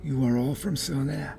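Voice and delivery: high, soft elf voice; elf woman. Acted. Elf addresses the gathered travelers, high soft voice. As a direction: pitch it up and keep it soft, as an elf.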